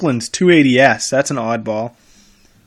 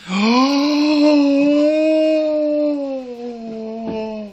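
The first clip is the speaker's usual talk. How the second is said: long, high delighted gasp; 12 semitones higher than usual. Real, not acted. Spotting a rare car in the listings, a long high delighted gasp.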